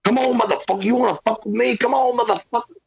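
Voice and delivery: high-pitched ranting challenge; Scarface. Retold or imitated. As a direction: rant it high, taunting an opponent to attack. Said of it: "like, Scarface rant".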